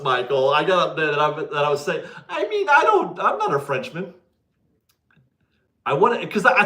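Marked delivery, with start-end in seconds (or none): 2.3-4.1 high-pitched protest. Real, not acted. Streamer excuses his French to a viewer, high-pitched protest. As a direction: voice leaps up high in self-defence. Defends himself after being corrected.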